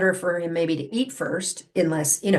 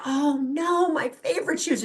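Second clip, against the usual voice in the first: mock dismay, pitched higher; a pitched-up wail over the shoes; the owner finding chewed shoes, in mock dismay; 8 semitones higher than usual. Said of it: "you're like".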